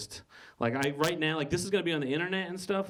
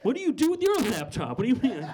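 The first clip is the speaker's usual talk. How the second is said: incredulous; 8 semitones higher than usual. Retold or imitated. Retelling a shop clerk exchange.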